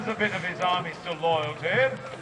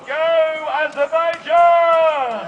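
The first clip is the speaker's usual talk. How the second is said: slow, high, full-throated shout; rally the crowd with a big drawn-out yell; stretched stadium shout; shouted cheer; 8 semitones higher, 9 dB louder, much slower than usual. Shown as a demonstration. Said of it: "If you want the scruffy Lord".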